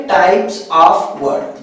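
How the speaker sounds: slow dictation. Shown as a demonstration. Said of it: "अगला देखें".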